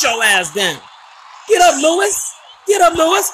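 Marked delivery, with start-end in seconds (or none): 1.5-3.3 high yelled taunt; shouting at the screen. Real, not acted. Player yelling at his downed opponent, loud taunt. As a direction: yell it at the knocked-down fighter.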